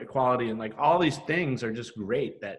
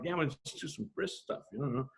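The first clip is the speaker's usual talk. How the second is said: softer, trailing off sheepishly; sheepish mumble; 9 dB quieter than usual. Retold or imitated. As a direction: mumble it like the underdog. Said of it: "and I'm like".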